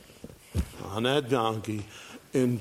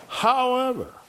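high warning however; 13 semitones higher, 4 dB louder than usual. Real, not acted. Preacher to congregation, high sharp warning. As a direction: throw "However" up high like an alarm. Preacher stops to warn the flock.

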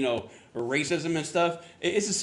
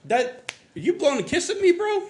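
incredulous, voice shooting up high on bro; shocked disbelief; 12 semitones higher than usual. Retold or imitated. Retelling a dinner with a stranger, high incredulous squeak. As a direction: pitch climbing way up in disbelief. Shocked at man blowing kiss.

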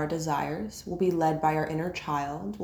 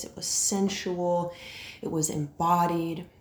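ordinary delivery

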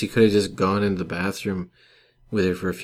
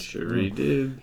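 sarcastic; 5 semitones higher, 3 dB quieter, slower than usual. Acted. Sarcastic echo of joke.

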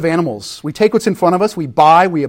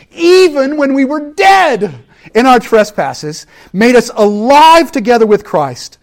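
loud, fervent, voice breaking on dead; preaching at full force; 8 semitones higher, 8 dB louder than usual. Acted. Preacher reading scripture to congregation, impassioned and loud. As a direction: proclaim it with emotion.

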